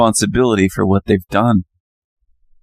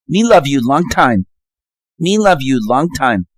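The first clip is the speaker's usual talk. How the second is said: crude put-on Vietnamese accent, a little higher; mock Vietnamese accent; 4 semitones higher than usual. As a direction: caricature a Vietnamese accent, sing-song. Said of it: "That's more Vietnamese".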